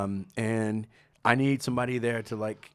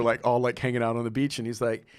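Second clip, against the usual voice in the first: ordinary talk, nothing marked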